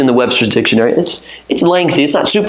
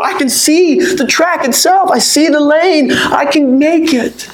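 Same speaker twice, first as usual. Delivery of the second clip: impassioned preaching; 10 semitones higher than usual. Acted. Preacher urging the congregation to run to Christ, fervently. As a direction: preach it with full conviction.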